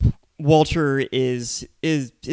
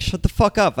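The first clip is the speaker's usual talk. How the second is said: raised-pitch dismissal; exasperated retort; 8 semitones higher, 5 dB louder than usual. Acted. Podcaster telling off an imagined celebrity guest, exasperated. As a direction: cut the show-off down, higher.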